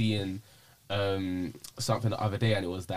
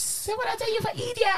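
a much higher, mocking take on the actress's line; imitated TV accent. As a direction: quote the line in her odd put-on accent. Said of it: "that accent".

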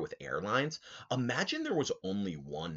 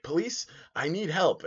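1 urgent plea; 11 semitones higher, 5 dB louder than usual. Retold or imitated. Cabbie pleading to police.

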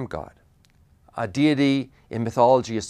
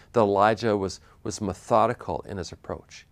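usual speaking voice

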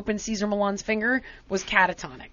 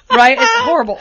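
laughing; 11 semitones higher, 9 dB louder than usual. Real, not acted.

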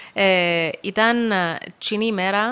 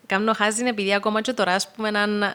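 ordinary talk

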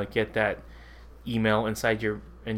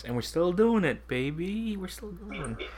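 lilting, higher-pitched mock triumph; playful self-hype; 8 semitones higher, slower than usual. Real, not acted. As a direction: stretch it out like a hype man winding down. Playful boast about nine years.

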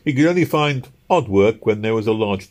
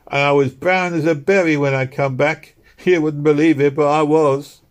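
higher, louder cheerful boast in a street woman's voice; London working woman; 7 semitones higher, 5 dB louder than usual. A narrator's reading.

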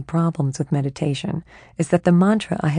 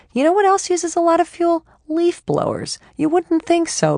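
digressing chatty mind; 7 semitones higher than usual. Acted. Her mind rambling about jet skis and leaf blowers, eager and chatty. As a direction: rant off on a tangent.

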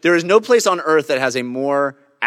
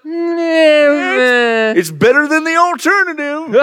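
put-on old hermit voice; 10 semitones higher, 4 dB louder, much slower than usual. Hermit voice answer.

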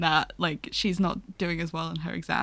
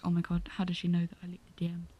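fast, much softer panicked inner voice; panicked thought; 2 semitones lower, 7 dB quieter, much faster than usual. Retold or imitated. Friend imitating a character's panic, fast and hushed. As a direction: rush it out under your breath. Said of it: "she sat there like".

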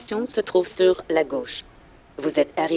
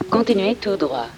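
cheerful synthetic GPS voice; bright, even navigation app announcement; chipper text to speech voice; 4 semitones higher than usual. Acted. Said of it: "Une voix de synthèse lui dicte le chemin".

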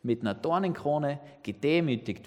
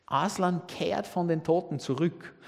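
usual speaking voice

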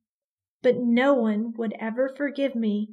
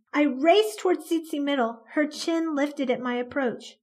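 ordinary delivery

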